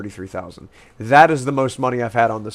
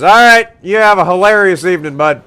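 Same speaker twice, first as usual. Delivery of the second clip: loud farewell; 7 semitones higher, 12 dB louder than usual. Acted.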